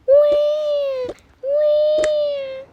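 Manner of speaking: loud high-pitched pretend baby wail; baby crying. Baby sister interrupting a phone call, pretend crying. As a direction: imitate a baby's drawn-out squeal. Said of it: "what's that crying?".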